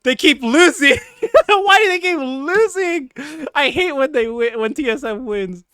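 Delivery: laughing at the team's losing streak; mock frustrated fan. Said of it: "So it's like".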